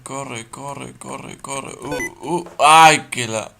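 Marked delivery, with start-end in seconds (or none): 2.6-3.1 sudden loud yelp of fright; startled yelp. Real, not acted. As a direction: jump out of your skin. Jump scare in horror game.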